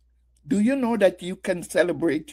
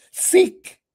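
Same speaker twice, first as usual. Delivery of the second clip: high, loud exhortation on Seek; preached cry; 14 semitones higher, 6 dB louder than usual. Acted. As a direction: throw Seek out like a summons from the pulpit. Preacher quoting scripture, exhorting.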